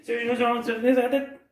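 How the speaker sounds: very quick, high, loud rattle; fastest. Shown as a demonstration. Coach racing children up to the head, fastest. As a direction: race up the list at top speed. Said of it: "Encore plus vite".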